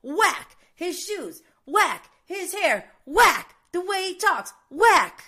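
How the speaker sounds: mock-yell it like a guy ranting on the street. Vlogger imitating a man yelling on the street, loud slow chant. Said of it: "this guy's yelling on the street going".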